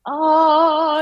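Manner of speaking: high loud oh of discovery; a bright surprised oh; sudden realization; mock surprise.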